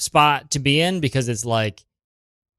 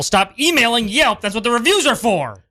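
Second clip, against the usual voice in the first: exasperated; 9 semitones higher than usual. Acted. Exasperated at spouse's emails.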